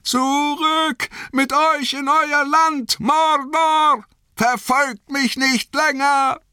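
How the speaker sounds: high, thin cry that lacks power; a small voice straining to command; high, slow shout with no power behind it; thin shrill voice. Acted. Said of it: "wie dünn und schrill seine Stimme klang".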